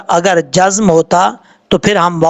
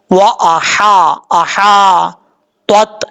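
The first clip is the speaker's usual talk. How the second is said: slow recitation demo; much slower than usual. Shown as a demonstration. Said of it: "इन दोनों ता में फ़र्क करना है".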